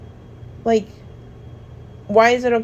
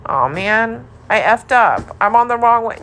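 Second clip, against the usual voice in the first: louder acted self-blame; mock regret; 4 semitones higher, 7 dB louder than usual. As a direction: act out someone sure they messed up. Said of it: "it sounds like".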